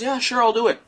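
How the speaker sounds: high, breezy teacher voice; imitating the teacher. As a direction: toss it off like a careless teacher. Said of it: "he goes".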